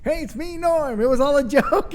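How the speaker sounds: high, loud comic reveal; high impression of the comic, loud on the joke; Norm Macdonald. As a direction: pitch it up high and announce the prank. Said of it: "that's really good Norm Macdonald impersonation".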